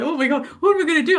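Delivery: fast, high mock panic; retold panic. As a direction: rattle it off like the sky is falling. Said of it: "I was like".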